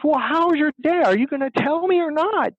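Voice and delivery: high-pitched mock nagging wife. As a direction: imitate a wife demanding a real answer. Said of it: "and she raises her".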